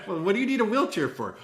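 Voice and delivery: high, laughing mock question; laughing while quoting. Retelling dad's wheelchair trick to friends, laughing. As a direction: laugh through the quoted question. Quoted hotel staff question.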